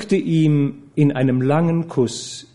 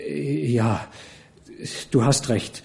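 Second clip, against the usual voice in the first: stammering; 6 dB quieter, slower than usual. Acted. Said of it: "Zutiefst getroffen stammelte Muffat".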